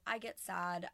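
usual speaking voice

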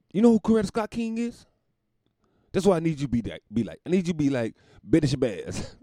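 hammed-up lecture to his woman; mock lecturing shout. Johnny lecturing, voiced.

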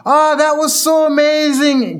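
high, gushing mock squeal; thrilled aunt. Aunt hugging her nephew after karting, thrilled. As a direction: act out an overjoyed aunt. Aunt's thrilled hug, acted out.

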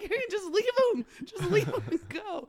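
giggling mock urging; laughing plea. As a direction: urge her to go through laughter. Urging friend to leave, laughing.

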